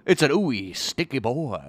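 ordinary delivery

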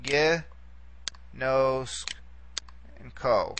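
"Gi, nos, and ko" split by long pauses; syllable by syllable. Shown as a demonstration. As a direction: sound out "Gi, nos, and ko" one syllable at a time. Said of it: "here we have three syllables".

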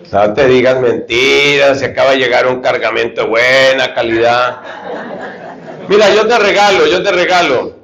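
the tempter as a dealer, laughing as he offers the marijuana for free. Acted.

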